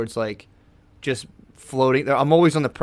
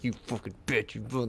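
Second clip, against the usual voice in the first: muttered self insult; 11 dB quieter than usual. Retold or imitated. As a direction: curse yourself quietly over the treat. Scolding himself over a treat.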